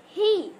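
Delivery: ही called out loud and high; stressed hi. Shown as a demonstration. Teacher stresses ही in historical for students. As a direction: punch ही hard. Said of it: "जोर किस पर दे रहे हैं हम".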